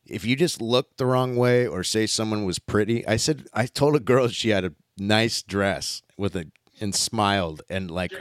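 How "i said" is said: ordinary talk, nothing marked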